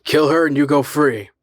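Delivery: loud, cold command. A narrator's reading. The unseen voice ordering the man to kill, loud and cold. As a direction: order it flatly from above.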